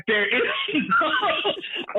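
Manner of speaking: breaking into a high laugh mid-phrase; slow words giving way to laughter. Real, not acted. Speaker insists to friend on the worst police, laughing.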